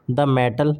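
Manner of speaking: usual speaking voice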